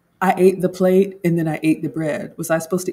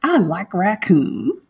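country girl impression; 8 semitones higher, 4 dB louder, slower than usual. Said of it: "she's like".

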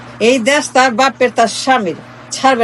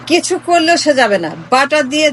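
usual speaking voice

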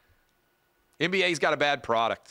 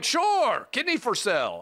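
high, squeaky mock Russian accent; much higher mock Russian seller, pitched way up on sure; 11 semitones higher than usual. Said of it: "I don't know if that's a good, uh, Russian accent or not".

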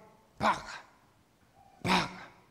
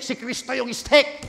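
loud, fervent preaching; preaching at full force; 5 semitones higher, 10 dB louder than usual. Real, not acted. Pastor urging the congregation about the oil, full-force preaching. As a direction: drive it home to the congregation.

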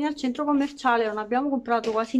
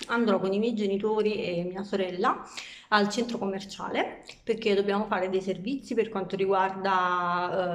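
ordinary talk

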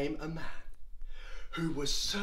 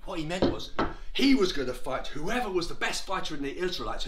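ordinary delivery

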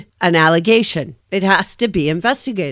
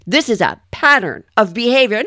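ordinary talk, nothing marked

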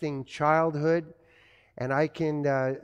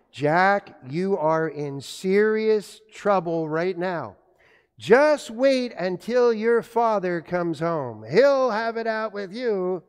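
scolding mother; 3 semitones higher, 4 dB louder, slower than usual. Retold or imitated. Mother warns son that father is coming home, slow scolding. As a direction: warn him slowly, like a mother threatening a child. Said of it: "my mother was famous for saying this".

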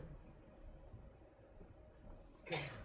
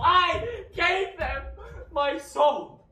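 loud, high, slow cry of confession; anguished shout; 7 semitones higher, 10 dB louder, much slower than usual. Acted. Faustus to scholars, crying out that he sold his soul. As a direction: wail it out in horror.

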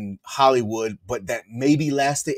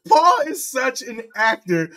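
laughing; 11 semitones higher than usual. Real, not acted. Cracking up after his impression.

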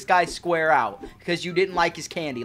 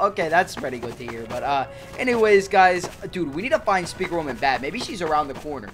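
normal talking voice

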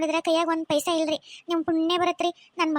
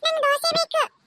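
put-on much higher little girl calling her mother; high childlike plea for food; child voice; 10 semitones higher, 4 dB louder, slower than usual. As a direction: play the hungry small daughter begging for dosa. Child asks mother for dosa.

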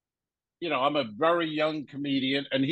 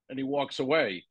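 normal talking voice

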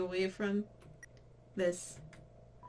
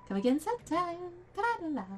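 much higher, lilting farewell; playful high sing-song goodbye; high singsong; 11 semitones higher, 4 dB louder, faster than usual. Pretend. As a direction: chirp it like a cheery shopkeeper. Mock shopkeeper farewell, singing.

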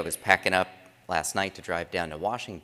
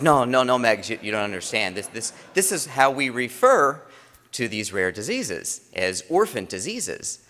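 louder, higher no no no, explaining; mock-urgent dad setting it straight; emphatic correction; 5 semitones higher, 6 dB louder than usual. Dad retelling correcting his daughter, louder. Dad protests in retold story.

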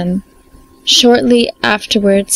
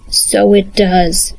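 slow grumbling troll; a lumbering troll agreeing; 2 semitones lower, slower than usual. Acted. Troll answers.

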